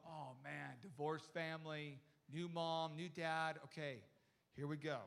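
low, weary bracing aside; understated "here it comes" resignation; resigned inner thought. Retold or imitated. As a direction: brace yourself for a heavy conversation. His inner dread, retold.

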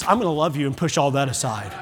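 low, soft voice of God; gentle, hushed divine promise; tender reassurance. Retold or imitated. Voicing God's loving answer, hushed.

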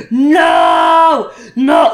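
mock Wookiee roar of no; Chewbacca yelling. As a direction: yell it like Chewbacca. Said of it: "she sounded like Chewbacca yelling no".

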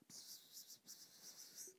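a hissed psst again and again, barely there; imitating a whispering friend. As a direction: hiss to get his attention without waking her. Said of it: "He's still whispering".

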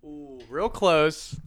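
ordinary delivery

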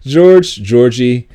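loud, high roll call of nicknames. Acted. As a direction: call out each name separately, loud and high. Acting mom calling kids' nicknames.

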